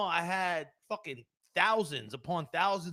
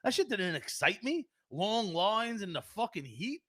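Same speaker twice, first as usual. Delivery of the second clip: normal talking voice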